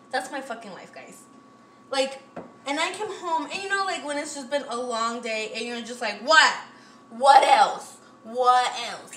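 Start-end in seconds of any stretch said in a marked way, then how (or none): 6.2-9.2 loud cry of being fed up; exasperated. Acted. Worn-out person coming home after a long day, loudly exasperated. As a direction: throw it out loudly, easing on the last repeat.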